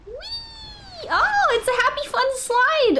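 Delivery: gleeful whee. Real, not acted. Riding a slide for fun.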